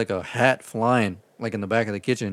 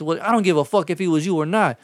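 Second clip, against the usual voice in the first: high, loud demanding caller; raised, pushy angry voice; irate customer; 7 semitones higher, 4 dB louder than usual. Retold or imitated. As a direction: imitate a customer who won't hear excuses. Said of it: "was not fucking happy about it".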